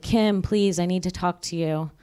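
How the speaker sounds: ordinary talk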